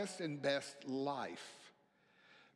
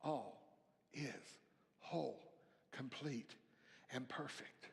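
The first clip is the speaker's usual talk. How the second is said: soft affirmation; 3 semitones lower, 9 dB quieter than usual. Acted. Sermon, pastor softly affirming all is whole to congregation. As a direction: lay each word down gently like a prayer. Meditative affirmation after pause.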